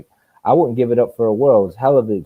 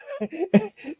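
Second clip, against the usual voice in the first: very high laugh trailing off; high burst of laughter; 7 dB quieter than usual. Real, not acted. Boxer laughing at his own story, high burst of laughter. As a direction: crack up.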